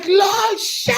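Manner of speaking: put-on high desperate shout of a child's name; yelling Lachey in alarm; screaming her name. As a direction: scream it across the street in panic. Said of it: "And I'm screaming".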